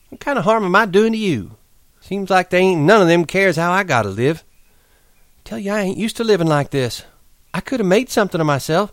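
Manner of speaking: bitter. Acted. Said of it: "she said darkly".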